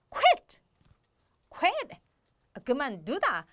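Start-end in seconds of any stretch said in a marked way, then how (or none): 0.1-2.0 quit shot out high and sharp, like slamming a door. Shown as a demonstration. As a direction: bark quit hard and high. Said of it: "단어에서도 느껴지죠".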